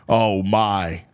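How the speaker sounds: ordinary talk, nothing marked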